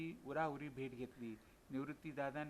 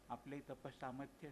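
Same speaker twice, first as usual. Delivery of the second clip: ordinary delivery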